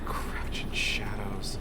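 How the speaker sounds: slow, soft whispered scorn; mocking whisper. Real, not acted. Streamer mocking the game's advice in a whisper. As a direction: breathe it out slowly, scornful.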